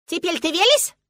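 put-on high, loud childish question; insistent little girl. A narrator's reading. Girl pressing father to believe her, insistent. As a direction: push him for an answer.

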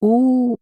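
long, high-pitched "oo" howl; high wailing howl. A narrator's reading. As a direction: howl it like a swamp creature. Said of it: "провыла болотница".

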